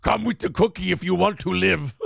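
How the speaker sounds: lightly put-on Arnold Schwarzenegger accent; a hint of Terminator Arnold; mock Arnold. Host riffs on the Arnold mix-up, light Arnold accent. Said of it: "bringing Arnold Schwarzenegger in it".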